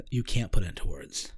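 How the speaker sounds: deep hushed verdict; quiet low voice. Retold or imitated. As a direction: drop low and soft. Voice tells him he can't.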